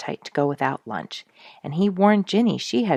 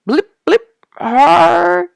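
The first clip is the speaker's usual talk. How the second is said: much higher, louder choked blips; strangled gurgling; 12 dB louder, much slower than usual. Acted. Duke trying to answer, choked gurgling noises. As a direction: try to speak with a swollen mouth. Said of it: "he began".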